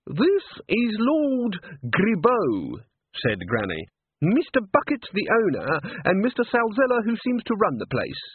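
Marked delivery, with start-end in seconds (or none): 0.1-2.8 put-on stiff old woman. Granny presents cat proudly. 4.2-8.2 put-on stiff old woman. Granny's introductions.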